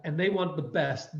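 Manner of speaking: ordinary talk, nothing marked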